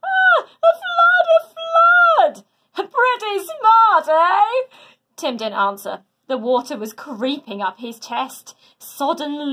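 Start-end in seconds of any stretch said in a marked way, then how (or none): 0.0-4.7 very high, loud, excited cry of pride; proud giant hero shouting with glee; boastful hero. A narrator's reading. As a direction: crow about it like a delighted giant. Hercules proud of his flood.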